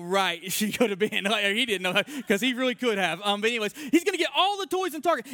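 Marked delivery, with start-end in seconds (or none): 3.9-5.3 high, quick mock yell; imitated child's yell. Preacher mimics kid afraid of missing out, high quick mock yell. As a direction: rattle off a kid's high-pitched protest. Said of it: "And he's yelling".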